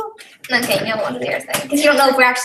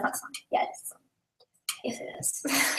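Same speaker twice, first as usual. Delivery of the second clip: whisper; 14 dB quieter than usual. Real, not acted. Teasing aside in a whisper.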